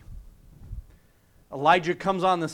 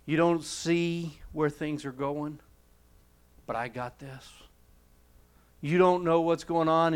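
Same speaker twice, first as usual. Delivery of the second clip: soft, steady comfort; gentle reassurance; 4 semitones lower, 6 dB quieter than usual. Retold or imitated. God reassures the despairing prophet, soft and steady. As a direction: settle him down, you've got this covered.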